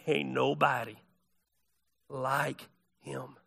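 low, soft Southern delivery; Southern accent. Acted. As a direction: say it as a quiet Southerner, pausing before "like him". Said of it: "translated into Southern".

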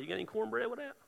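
mock nonchalant question, slightly high and soft; trying to be cool. Peter asks Jesus for cornbread, trying to be cool. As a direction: play it casual, covering nerves. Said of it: "He probably tried to be cool".

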